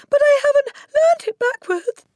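squeaky, very high, panicked voice; stammering schoolboy. Acted. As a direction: protest as a frightened little boy, high and loud. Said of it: "stammered Wilfred".

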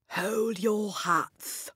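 ordinary delivery

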